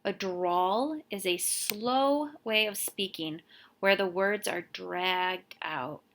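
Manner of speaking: slow, stretched-out words; slow drawl demo. Shown as a demonstration. Teacher showing the class a slow drawl. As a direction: drag the words out slowly to show a drawl. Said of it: "is drawl".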